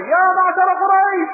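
loud, high call to the crowd. Retold or imitated. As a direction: cry out to gather the tribe. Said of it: "بدأ ينادي".

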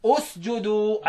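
"أسجدوا" begun with a long, slow u; starting with damma. Shown as a demonstration. Teacher showing how to start the verb with damma. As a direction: open "أسجدوا" slowly with a clear u sound. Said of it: "أبدأ أقول إيه".

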